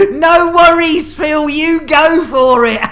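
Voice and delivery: words spilling through loud laughter; laughing. Real, not acted. Cracking up at the clip, laughing to the player. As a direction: laugh it out, barely getting words in.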